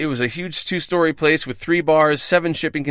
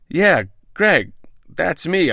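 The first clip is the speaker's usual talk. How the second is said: higher-pitched, mildly flustered answer; fumbled reply; 4 semitones higher, much slower than usual. A narrator's reading. Drunk job seeker greeting the manager, mildly fumbling. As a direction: answer as if slightly caught off guard. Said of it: "I fumbled".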